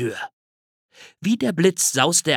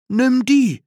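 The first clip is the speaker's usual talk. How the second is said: higher, louder kindly offer; lightly voiced bear offering; 12 semitones higher, 6 dB louder than usual. A narrator's reading. Bear gives the squirrel a rod, cheerful offer. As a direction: hand it over with a flourish.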